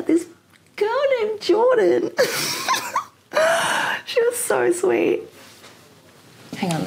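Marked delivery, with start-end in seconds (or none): 0.0-5.2 high, slow giggly gush; laughing while telling. Real, not acted. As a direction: gush through a real laugh about Jordan. Gushing about fan in water.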